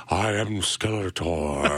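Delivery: low, slow, dark villain voice; dark deep Skeletor. Retold or imitated. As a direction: intone it low and sinister. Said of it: "dark or deeper".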